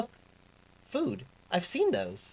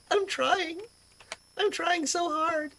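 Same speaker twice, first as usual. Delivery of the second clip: high-pitched plea, last words drawn out; high, laughing-at-yourself plea of effort; strained protest; 9 semitones higher, slower than usual. Real, not acted. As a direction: protest helplessly in a much higher voice. Pleading after failed accent attempts.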